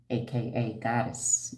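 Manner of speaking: usual speaking voice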